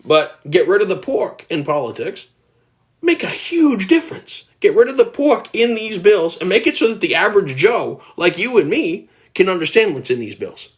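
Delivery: ordinary delivery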